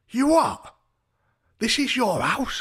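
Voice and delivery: very high, loud outraged imitation; mock drunken shout. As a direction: shriek it as an affronted guest. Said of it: "just shouting".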